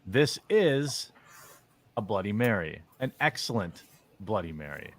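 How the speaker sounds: ordinary talk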